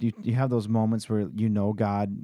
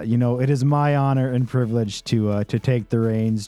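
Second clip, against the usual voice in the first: upbeat podcast outro read; announcer; 3 semitones higher, 5 dB louder than usual. Acted. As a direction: sign off like a host. Podcast outro read over music.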